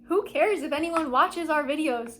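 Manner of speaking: normal talking voice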